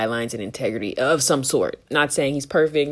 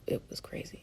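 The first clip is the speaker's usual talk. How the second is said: stunned whisper; 18 dB quieter, faster than usual. Real, not acted. Stunned after debate rant.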